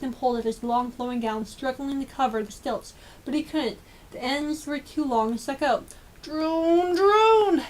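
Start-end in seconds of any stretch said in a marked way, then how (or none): 6.2-7.6 high, louder frightened cry; startled and caught, rising alarm; panicked gasp. Acted. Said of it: "gasped Lord Droon".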